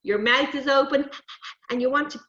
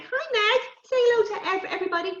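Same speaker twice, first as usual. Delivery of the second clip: higher, sing-song greeting to a dog; pet talk; 10 semitones higher, faster than usual. Real, not acted. Teacher greets her dog Meg in higher pet talk.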